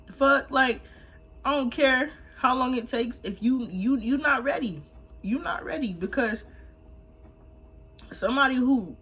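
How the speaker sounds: high, loud, worked-up ranting; heated rant. Real, not acted. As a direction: get fired up and preach it. Heated rant, much higher and louder.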